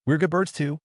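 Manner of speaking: unsure, lifted a little, testing the idea; hesitant. A narrator's reading. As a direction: say it tentatively, half asking. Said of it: "Lai Wu hesitantly said".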